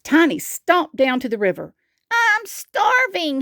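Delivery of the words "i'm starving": high, slow hungry moan; drawn-out high whine. A narrator's reading. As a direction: moan like a starving little dinosaur. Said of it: "he moaned".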